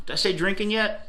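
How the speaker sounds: ordinary talk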